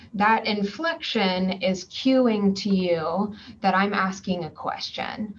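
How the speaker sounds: ordinary talk, nothing marked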